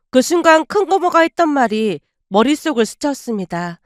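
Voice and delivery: ordinary delivery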